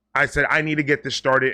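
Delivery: ordinary delivery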